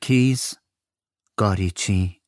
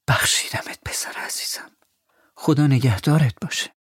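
whispered loving goodbye; tender whisper; 5 semitones higher than usual. Acted. The mother embraces her son and whispers goodbye. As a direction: hold him close and whisper.

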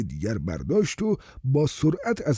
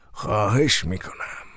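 pleading; 4 semitones lower than usual. Acted. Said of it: "با التماس گفت".

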